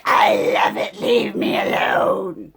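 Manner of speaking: hammed-up teen outburst; mock teen yell. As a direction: shout like a sulky teenager. Retold teen self yelling at mom.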